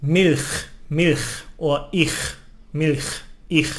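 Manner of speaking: Milch and ich with a harsh throaty ch; strong Swiss ch sound. Shown as a demonstration. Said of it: "it's quite strong".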